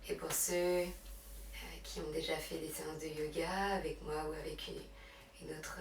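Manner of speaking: hushed, unhurried meditation guidance; guided relaxation. Real, not acted. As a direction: guide them gently into breath and rest. Leads yoga relaxation and breathing.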